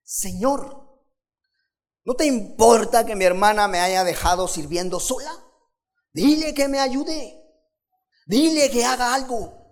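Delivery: high, loud, fast exasperated plea; overwhelmed Martha complaining. Acted. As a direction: demand help from Jesus, fed up. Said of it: "se acercó a él y le dijo a Jesús".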